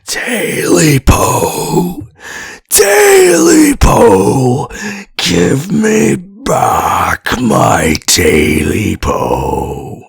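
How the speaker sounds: eerie creature wailing for its tail. Acted. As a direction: howl for the lost tail.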